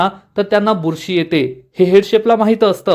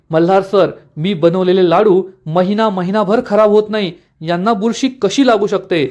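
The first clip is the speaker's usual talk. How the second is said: usual speaking voice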